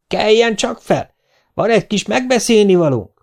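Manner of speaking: high-pitched demanding woman; Hollóné hangja. A narrator's reading. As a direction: summon a sleeper from outside the door. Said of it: "hallatszott Hollóné hangja". (magas, követelőző asszonyhang)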